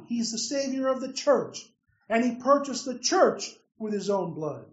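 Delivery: ordinary talk, nothing marked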